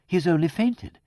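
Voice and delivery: high, tentative hopefulness; hoping against hope. A narrator's reading. Vicar doubting the death, hoping against hope. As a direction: offer it as a faint hope. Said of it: "as one who hopes against hope".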